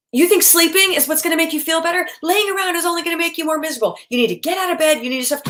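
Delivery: high, fast, overwrought lecturing; hysterical parent. Shown as a demonstration. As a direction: nag like a panicking parent. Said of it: "bad example".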